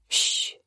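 en blød hvisket tyssen; tyssende shh. A narrator's reading. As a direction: hvisk et stille shh med fingeren for munden. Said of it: "siger han, og lægger en finger for munden". (et hvisket shh med finger på munden)

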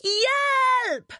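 high, drawn-out panicked shriek; scream for help. A narrator's reading. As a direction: scream it as if hurtling downhill. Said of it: "skriger Rita". (højt skrig om hjælp)

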